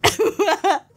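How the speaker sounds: high burst of helpless laughter; laughing, losing composure. Real, not acted. Creator failing to read it straight, laughing. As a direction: break character and laugh. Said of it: "can't even read this with a straight face".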